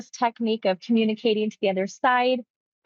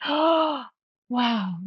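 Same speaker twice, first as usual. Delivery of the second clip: higher, wondering oh wow; awed oh wow; 4 semitones higher than usual. Retold or imitated. Client seeing the other side, higher awed wow. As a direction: let wonder lift the voice. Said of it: "he goes".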